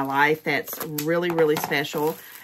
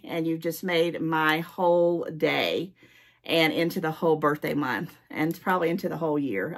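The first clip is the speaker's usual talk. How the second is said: normal talking voice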